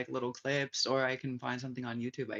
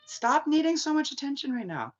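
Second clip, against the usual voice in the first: much higher, sharp scold; scolding aside; 12 semitones higher, 5 dB louder than usual. Real, not acted. To someone nearby, higher scolding aside. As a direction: snap at whoever wants attention.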